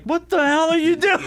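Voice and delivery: Jesus scolds from on high, high and loud; mock outraged Jesus. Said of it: "Jesus is like".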